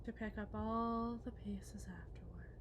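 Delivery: breathy hushed whisper. Acted. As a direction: murmur it like a martyr. Wife self-pitying aside.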